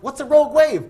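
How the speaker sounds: guests' alarm. Retold or imitated. Guests asking the captain, high mock alarm. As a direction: ask it in mock panic. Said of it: "We're like".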